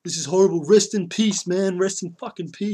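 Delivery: mock the people grieving loudly; mock outraged mourner. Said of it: "you get all outraged and you're like".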